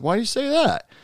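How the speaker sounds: surprised question. Retold or imitated. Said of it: "And I said".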